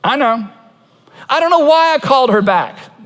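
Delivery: mild mock exasperation; exasperated confession. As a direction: sheepishly admit the bad purchase. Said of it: "Jeremiah and all your friends say".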